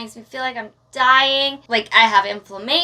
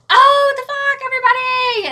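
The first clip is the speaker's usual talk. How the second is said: much higher, louder distressed cry; hammed-up dread of the crowd; mock overwhelmed; 12 semitones higher, 4 dB louder than usual. Retelling being out in public while sick, crying out.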